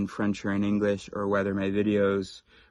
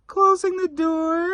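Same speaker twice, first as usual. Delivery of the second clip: very high, breathy, hesitant falsetto with long pauses; exaggerated polite coworker; 7 dB louder, slower than usual. Shown as a demonstration. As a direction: squeak it out as an over-apologetic colleague. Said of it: "There was the timing".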